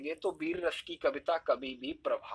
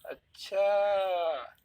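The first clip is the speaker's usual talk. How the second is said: long drawn-out, higher अच्छा; knowing, teasing अच्छा; 4 semitones higher, 3 dB louder, much slower than usual. Shown as a demonstration. Teasing a friend who is hiding something, long knowing अच्छा. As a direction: stretch it out as if on to a friend's secret. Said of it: "friend circle में कैसे कहते हैं".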